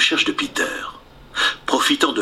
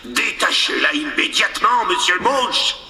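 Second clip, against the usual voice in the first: commanding pirate captain voice; Captain Hook voice; 6 semitones higher than usual. Acted. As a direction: order it like the captain. Peter as Hook orders.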